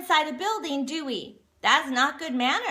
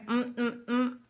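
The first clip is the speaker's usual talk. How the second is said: soft humming chew with lips shut; quiet mm mm mm of chewing; closed-mouth chewing hum; 5 dB quieter, slower than usual. Shown as a demonstration. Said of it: "Practice going".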